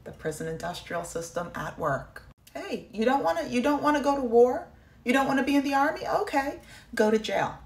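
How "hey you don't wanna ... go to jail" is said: sarcastic mock offer. Mocking the prison system.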